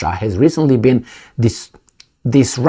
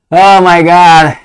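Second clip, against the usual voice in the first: slow, louder, higher groan of disbelief; exasperated oh my god; 6 semitones higher, 6 dB louder, slower than usual. Real, not acted. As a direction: drag it out in disbelief. Exasperated after laughing.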